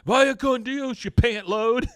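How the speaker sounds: bang-on-the-glass heckling; high loud heckle; mock yelling kids.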